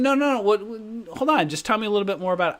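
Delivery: high, quick, insistent mock prospect; imitated eager interruption. Prospect stops the departing seller, imitated as eager.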